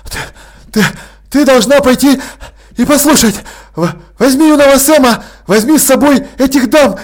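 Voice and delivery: breathless, high, loud blurting; urgent panting report. Acted. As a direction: gasp it out after a sprint. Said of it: "задыхаясь, выпалил".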